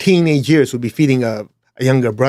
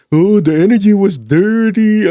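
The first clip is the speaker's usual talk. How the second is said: higher, louder, slow whiny mock of a complainer; 7 semitones higher, 5 dB louder than usual. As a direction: drag it out like a spoiled whiner. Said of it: "Some of these people complaining".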